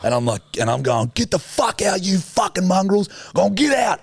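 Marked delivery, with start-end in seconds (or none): 1.1-4.0 much higher, yelling the dogs away; shooing shout. Retold or imitated. Scaring off street dogs around the tuk-tuk, yelling. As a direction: chase off a pack of strays.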